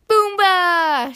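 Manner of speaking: high, loud, drawn-out call; high-pitched game cry; playful shout. A narrator's reading. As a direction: shout it like a kid at play. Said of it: "shouted Flop".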